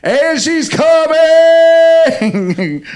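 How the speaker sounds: huge high, loud, long-held shout; sports commentator climax. Pretend. Commentator parody reaching the winning moment, shouted goal call. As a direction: bellow the winning goal, stretching the last word. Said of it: "as a sports commentator".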